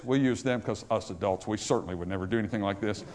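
low, soft, wry aside; tongue-in-cheek aside. Real, not acted. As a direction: drop the voice for a knowing joke. Tongue-in-cheek aside about adults.

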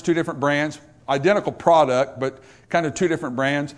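ordinary talk, nothing marked

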